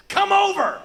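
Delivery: put-on very high, loud kid's yell; demanding little boy. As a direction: holler it down the phone like a child. Little boy yelling on phone.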